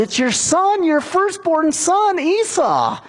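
high, insistent cry, stretching out the words; high-pitched protest. Retold or imitated. Acts Esau's appalled answer to father.

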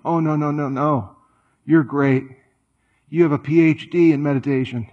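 usual speaking voice

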